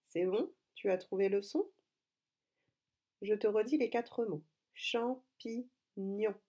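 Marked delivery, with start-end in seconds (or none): 4.7-6.4 Champignon split very slowly into cham, pi, gnon; syllable by syllable. Shown as a demonstration. Teacher repeating the words for pupils, syllable by syllable. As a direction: sound out "Champignon" slowly, pausing between its three syllables.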